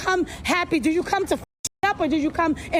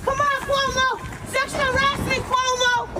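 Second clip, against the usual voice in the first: higher, sing-song taunting calls; mocking call-out; 5 semitones higher, slower than usual. Interviewer heckling Cuomo from the street, higher taunting calls. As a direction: heckle in a raised chant.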